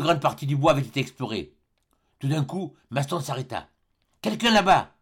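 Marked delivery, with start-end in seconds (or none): 4.2-4.9 high, sudden alert on spotting someone; urgent call. A narrator's reading. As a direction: call out a startled sighting, pitched high. Spotting someone during the search. (appel pressant)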